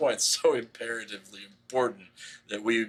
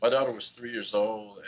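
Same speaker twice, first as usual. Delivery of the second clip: normal talking voice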